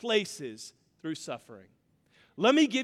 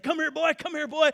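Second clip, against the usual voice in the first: higher, louder call of a country dad; 6 semitones higher, 4 dB louder, faster than usual. Retold or imitated. Father calling his son over to the cleaning table, higher and louder.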